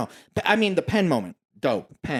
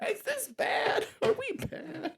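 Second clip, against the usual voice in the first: high small voice; 9 dB quieter, slower than usual. Pretend. Mock worried tiny voice.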